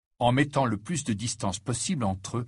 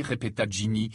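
ordinary delivery, nothing marked

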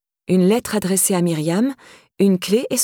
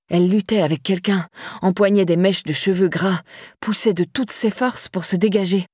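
ordinary delivery

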